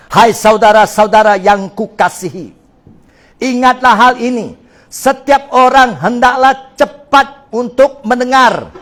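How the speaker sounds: loud, slow, solemn Bible reading; scripture reading. Acted. Pastor reading the verse to the church, loud and slow. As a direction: proclaim each word of the verse.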